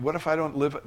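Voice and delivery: normal talking voice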